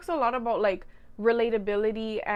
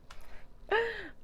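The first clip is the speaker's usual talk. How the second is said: high giggle; 11 semitones higher, 6 dB quieter than usual. Real, not acted. Laughs after joke.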